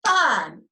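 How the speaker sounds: "Fine" as one loud beat; "Fine" said loudly to a clap; clapped syllable. Shown as a demonstration. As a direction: punch out "Fine" in a single syllable. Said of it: "let's clap it first".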